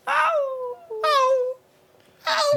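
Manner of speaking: high yelping fox scream; mock fox screaming in heat. Foxes scream when having sex.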